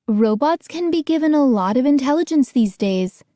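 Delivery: usual speaking voice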